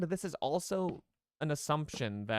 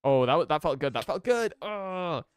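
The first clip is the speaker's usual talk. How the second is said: excited; 5 semitones higher, 8 dB louder than usual. Real, not acted. Big jump in game.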